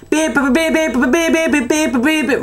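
squeaky repeated beeps; high beeping imitation. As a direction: beep like an annoying pest. Imitating man beeping to annoy.

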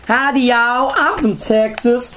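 high, slow cartoon Texan accent; proper hillbilly accent. Shown as a demonstration. Host showing co-host the accent, proper Texan hillbilly. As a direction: ham up a Texas hillbilly drawl. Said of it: "Think like proper".

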